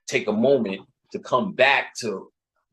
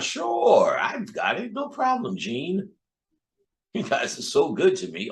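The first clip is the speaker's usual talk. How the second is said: mock eager worker, swooping higher; eager employee; 6 semitones higher than usual. A worker sucking up to his boss Gene, mock eager. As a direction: play the suck-up for the boss. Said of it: "the professional when you're at your job".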